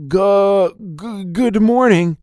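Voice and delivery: high, stammered greeting; nervous stutter. A narrator's reading. Smitten boy greeting the girl, high stuttering. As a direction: trip over the first syllable, pitched high. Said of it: "all he could say was a stuttering".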